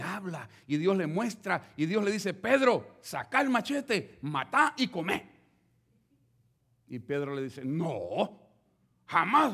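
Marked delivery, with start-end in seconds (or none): 2.3-5.3 loud, slow commanding call; booming divine order; voice of God. Acted. God commands Pedro in vision. 7.6-9.5 refusing. Acted. Pedro refuses God.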